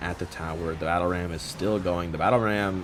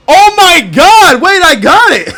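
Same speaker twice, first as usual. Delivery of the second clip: very high, loud, slow then laughing; elated scream; 17 dB louder, slower than usual. Real, not acted. Gamer celebrating a clutch save, loud scream with laughter. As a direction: scream in joy and burst into laughter. Winning a close moment.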